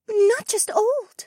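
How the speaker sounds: very high, slow correction; insistent. A narrator's reading. Bird companion corrects her lord, slowly insisting. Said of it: "she insisted".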